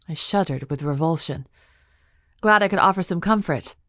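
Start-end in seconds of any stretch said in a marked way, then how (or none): none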